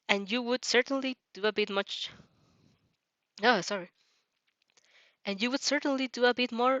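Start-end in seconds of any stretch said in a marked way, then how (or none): none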